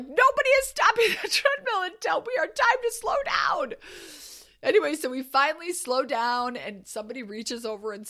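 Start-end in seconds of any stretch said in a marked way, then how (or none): 0.0-3.7 very high shout broken by a laugh; drill sergeant shout. Retold or imitated. Coach to gym, high shout through a laugh. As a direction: yell the order, laughing at yourself. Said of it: "And I'm like".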